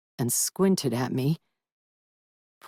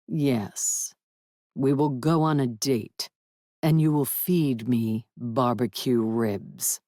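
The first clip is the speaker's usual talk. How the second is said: a lightly voiced stately dragon, somewhat lower; proud dragon; 4 semitones lower, slower than usual. Dragon praising his mate to Willard, lightly lower and stately. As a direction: speak as a stoic, aloof dragon lord.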